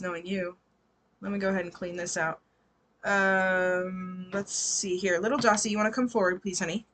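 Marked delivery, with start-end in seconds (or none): none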